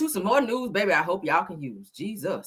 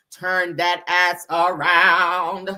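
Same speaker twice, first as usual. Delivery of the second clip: slow, flat robot voice talking aggressively; robotic Martian; 3 semitones lower, much slower than usual. Retold or imitated. Host mocking a Martian robot voice, slow and robotic. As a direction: drag each word out like a bossy alien machine. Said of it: "Real robotic and shit".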